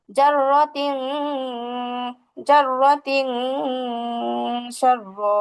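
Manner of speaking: জাররাতিং with a long "ng" hummed in the nose; sustained nasal hum closing জাররাতিং; hidden nasal ghunna. Shown as a demonstration. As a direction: recite জাররাতিং slowly, holding the nasal ending. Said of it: "গুণনাটা কিন্তু নাকের ভিতরে লুকায়া করতে হবে".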